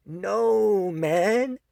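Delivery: squeaky high dismissive no; high mock refusal. Vlogger refusing fans of the ending, squeaky and dismissive. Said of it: "And I'm like".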